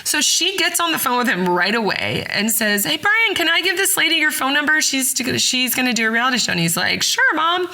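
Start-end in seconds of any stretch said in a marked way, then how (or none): 2.8-6.5 lightly voiced mom, higher and quick; chirpy mom on the phone, played higher; imitating the mom. Mother phoning her son Brian, higher imitation. 7.0-7.7 a hint of a bored son, pitched up; imitating the son. Son agreeing to his mother on phone, light imitation.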